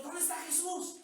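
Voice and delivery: high-pitched frantic mock cry; mock desperate disciples. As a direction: act out a desperate search. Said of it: "podemos estar como los discípulos".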